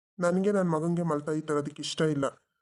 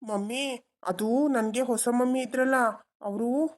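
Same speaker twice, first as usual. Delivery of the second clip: put-on higher, childlike voice for the son; little boy; 6 semitones higher than usual. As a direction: play the small boy tugging at his dad. Adult voicing the child. (ಪುಟ್ಟ ಹುಡುಗನ ಧ್ವನಿ)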